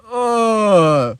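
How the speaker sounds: high, drawn-out mock groan of disgust. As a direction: groan as if hit by a stench. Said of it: "you could almost hear, like, the".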